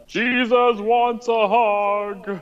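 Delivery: high, slow, put-on quote voice; silly quoted voice. Quoting a line in a put-on voice.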